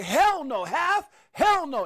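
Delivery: high, loud incredulous retort; loud disbelief. Retold or imitated. Retelling his reaction to crash predictions, loud and high. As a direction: shout back your own disbelief. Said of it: "And I was like".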